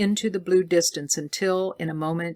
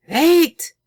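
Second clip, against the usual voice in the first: 1 sharp cry; 13 semitones higher, 9 dB louder than usual. A narrator's reading. Scientist cuts Northwood off.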